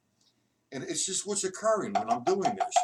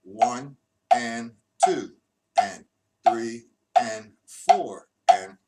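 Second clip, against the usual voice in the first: "one and two and three and four" stretched out, much louder; very slow loud count; 11 dB louder, much slower than usual. Shown as a demonstration. Teacher demonstrating line three, very slowly and loudly. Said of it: "If I did line three".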